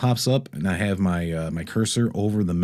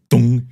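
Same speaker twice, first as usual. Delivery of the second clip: loud, booming "dong" impact noise; imitated thud; 3 semitones higher, 9 dB louder than usual. Driver retells hitting something unseen, loud imitated impact. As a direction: punch out a sudden loud bonk.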